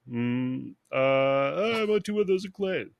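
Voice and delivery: hesitate, then rush into high mimicry; high, quick parroted order; mimicking the lady. Bum repeats the lady's order to the cashier, high mimicking voice. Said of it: "and mimics her".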